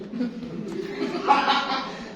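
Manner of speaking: high, breathy burst of laughter; real chuckling outburst; amused laugh. Real, not acted. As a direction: laugh out at your own joke. Laughs at his ghost remark.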